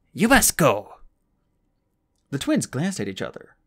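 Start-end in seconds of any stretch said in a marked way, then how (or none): 0.1-0.9 loud, blunt order to leave; abrupt command. Acted. The old woman ordering Flamel out, loud and blunt. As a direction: throw it at them, final. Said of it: "announced abruptly".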